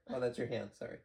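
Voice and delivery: ordinary talk, nothing marked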